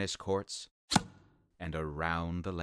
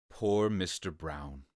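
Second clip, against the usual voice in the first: plain reading voice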